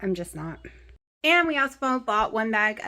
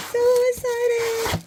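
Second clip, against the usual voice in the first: high, slow squeal of delight; excited squeal; 13 semitones higher, 4 dB louder, slower than usual. Real, not acted.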